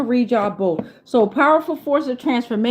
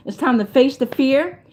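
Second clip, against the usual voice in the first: normal talking voice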